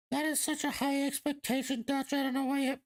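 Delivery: high mocking falsetto, fan complaining; mock scolding fan. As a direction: imitate a fan's protest in a high put-on voice.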